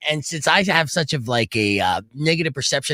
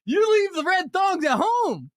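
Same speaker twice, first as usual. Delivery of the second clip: much higher, slow, shouted through laughs; laughing mock lecture; slower than usual. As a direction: scold the buddy while cracking up. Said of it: "I'm like".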